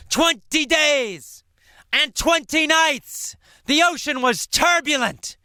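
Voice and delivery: high, excited storytelling of the storm; dramatic excitement. Shown as a demonstration. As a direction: build the drama of crashing waves. Storm climax told with big energy.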